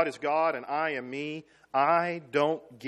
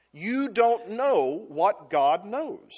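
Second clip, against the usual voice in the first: ordinary talk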